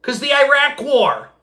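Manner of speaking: high, slow, dopey voice; slow high airhead imitation; dumb mock answer. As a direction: play her as clueless.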